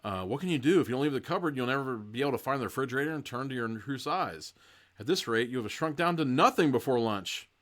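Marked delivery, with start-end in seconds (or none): none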